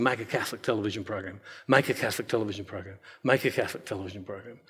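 low, hushed, quick murmur of the inner voice; a hushed, insistent inner voice; voice in his head. Retold or imitated. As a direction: repeat it like a voice you can't shut off. Quoting God's persistent voice.

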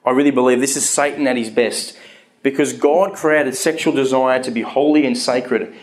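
usual speaking voice

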